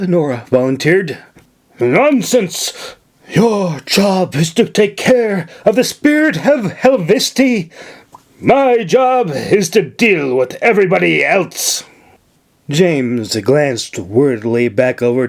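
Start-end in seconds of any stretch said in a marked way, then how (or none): 1.8-11.8 higher, dismissive firm refusal; brusque governor. Acted. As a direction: wave off her offer. Governor refuses her help.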